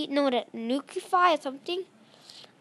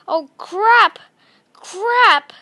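alarmed yelp; 4 semitones higher, 10 dB louder, much slower than usual. Real, not acted. Taking damage in game.

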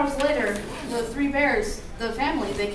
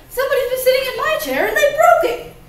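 much higher, louder cub voice; high, loud baby bear complaint; upset Baby Bear; 10 semitones higher, 7 dB louder, faster than usual. Acted. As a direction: wail it like an upset little bear. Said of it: "Baby Bear's like".

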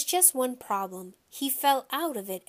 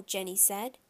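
ordinary delivery

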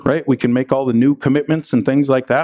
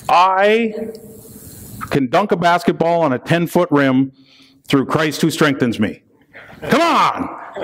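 mock hype; 8 semitones higher than usual. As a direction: fake a pep-rally brag about dunking. Mocking misuse of the verse.

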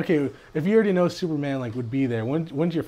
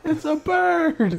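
high, stretched-out cry; hammed-up wonder; mock excitement; 14 semitones higher, 3 dB louder, much slower than usual. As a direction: draw it out like an amazed onlooker. Mocks the overexcited onlooker.

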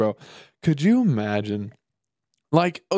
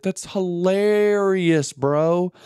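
slow, higher and stretched like a laugh; the joke still tickling him; drawn-out amusement; 6 semitones higher, much slower than usual. Real, not acted. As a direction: savour the word hilarious. Marvelling at the drunk survival story.

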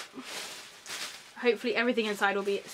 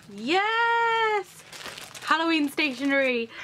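delighted yes; 6 semitones higher, slower than usual. Real, not acted. Unboxing Halloween stationery, a high joyful cry. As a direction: cheer out at the find.

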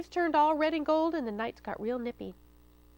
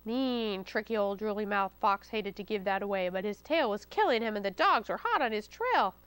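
usual speaking voice

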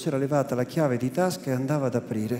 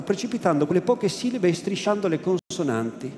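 usual speaking voice

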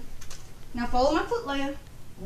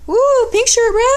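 high loud whoop; excited cheer; 11 semitones higher, 7 dB louder than usual. Real, not acted. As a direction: cheer at the pink shirt. Excited about the pick.

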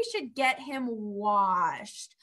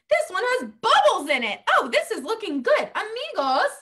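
normal talking voice

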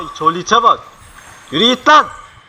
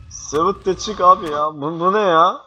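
ordinary talk, nothing marked

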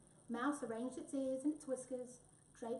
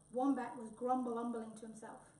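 ordinary delivery, nothing marked

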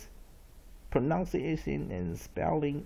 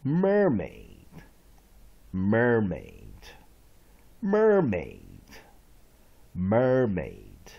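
loud, measured word drill; read-along model; 5 semitones lower, 7 dB louder, much slower than usual. Shown as a demonstration. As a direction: pronounce it firmly for students to copy. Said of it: "read along with me". (大声领读单词)

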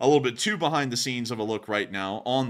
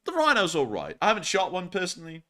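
usual speaking voice

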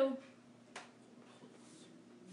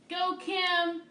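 higher and louder cheer for the winner; root for her out loud; cheering Kim for winning the quiz; 8 semitones higher, 6 dB louder than usual. Real, not acted. Cheering a viewer's win.

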